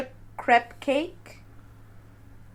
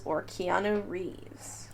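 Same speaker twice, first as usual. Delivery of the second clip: whispering; 3 semitones lower, 11 dB quieter, much slower than usual. Real, not acted. Whispered option.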